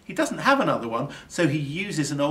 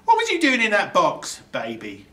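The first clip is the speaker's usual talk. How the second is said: louder, higher rough questioning; gruff burglar to baby; 8 semitones higher, 8 dB louder than usual. A narrator's reading. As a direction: quiz the baby bluntly. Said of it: "he says".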